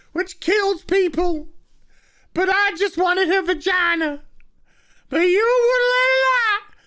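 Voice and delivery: high, hammed-up squeaky villain rant; high mock villain voice, overacted. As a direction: ham up a ridiculous villain's threat. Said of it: "takes the microphone and says".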